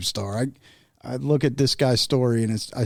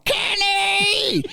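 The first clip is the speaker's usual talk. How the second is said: very high, drawn-out holler; high mimicked granny shout; granny keeps calling. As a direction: imitate an old woman yelling a name from upstairs. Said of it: "she just keeps calling".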